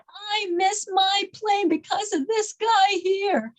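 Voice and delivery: high, slow indignant complaint; upset, retold. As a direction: imitate an upset passenger blaming someone. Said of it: "she was so upset".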